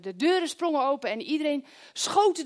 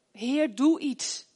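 slow plea; 2 semitones higher, much slower than usual. Retold or imitated. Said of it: "En toen dacht ik".

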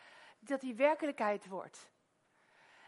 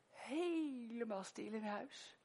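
normal talking voice

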